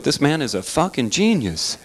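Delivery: usual speaking voice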